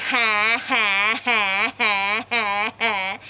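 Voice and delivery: a hint of a big mother duck laughing; mummy duck laugh. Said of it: "Mummy Duck goes".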